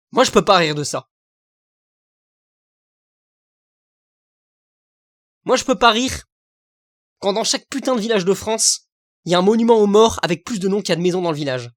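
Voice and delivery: loud, heated insistence; indignant anger. Real, not acted. Vlogger on village war memorials, loud indignation. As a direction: hammer it home, still fuming.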